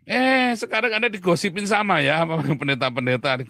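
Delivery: loud, high teasing jab; teasing exclaim. Real, not acted. Host teasing the pastor about gossip, loud and high. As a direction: tease him about the gossip, loudly.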